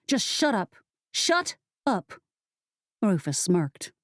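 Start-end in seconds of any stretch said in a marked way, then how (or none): 0.1-0.8 rising angry "shut up", higher. Acted. Pepper snaps at Rufus. 1.1-2.1 "Shut up" much higher, pitched up in fury; a sharper second "Shut up"; angry outburst. Acted. Pepper loses patience with Rufus, sharper anger.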